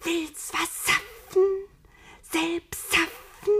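high, slow, sibilant snake voice; slow serpent lisp in a high whisper; hissing voiceless whisper. A narrator's reading. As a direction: whisper temptingly, drawing out every hiss. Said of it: "Es zischte und wisperte ganz ohne Stimme".

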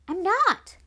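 high, loud cut-in; quick indignant "not me"; indignant protest. A narrator's reading. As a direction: jump in to object, pitched right up. Youngest cuts in indignantly.

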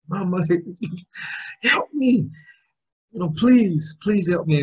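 high, mock-desperate begging; pleading with mama. Son begging his mother for help, high pleading.

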